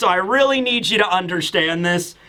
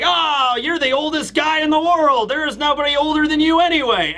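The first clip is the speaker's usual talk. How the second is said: high-pitched, blurting tipsy retort; drunken teasing; 6 semitones higher than usual. Retold or imitated. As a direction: play a tipsy loudmouth brushing off an old man. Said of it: "drunk, is just like".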